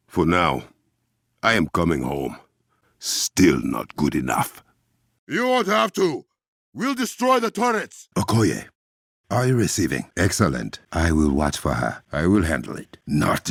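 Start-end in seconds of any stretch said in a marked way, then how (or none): 5.3-8.1 battle shout. Acted. Combat callout.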